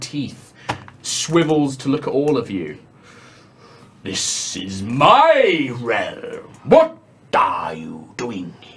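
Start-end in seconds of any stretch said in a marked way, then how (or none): 4.0-8.7 a low, drawn-out whisper from a floating eye; slow monster whisper. Acted. Eye monster questions intruders in its realm, slow low whisper. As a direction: breathe it slowly like a monstrous eye. Eyeball monster confronts the party.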